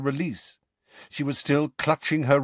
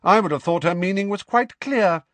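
higher, louder and curt; harsh McGonagall; 10 semitones higher, 5 dB louder than usual. Acted. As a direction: cut Umbridge off sharply. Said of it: "said Professor McGonagall harshly".

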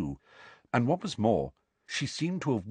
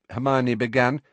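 ordinary delivery, nothing marked

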